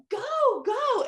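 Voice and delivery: very high imitated holler; frustrated mock yell. Mom shouting again at daughter who can't hear, frustrated mock yell. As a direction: hurry her along, high and exasperated. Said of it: "the mom was, like, so frustrated".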